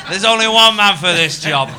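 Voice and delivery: mock grand casting call. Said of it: "It was".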